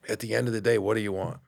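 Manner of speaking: usual speaking voice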